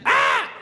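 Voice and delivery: high mock baby wail; short imitated infant cry. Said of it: "he was crying".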